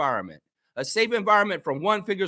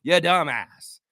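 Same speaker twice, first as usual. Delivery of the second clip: usual speaking voice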